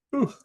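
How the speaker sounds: a long open-mouthed yawn; big yawn. Real, not acted. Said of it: "Sorry for the yawn".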